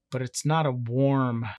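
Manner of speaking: usual speaking voice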